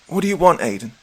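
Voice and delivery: sharp demand. Acted. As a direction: snap the question upward. Allison demanding from beside him.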